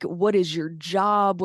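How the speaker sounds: normal talking voice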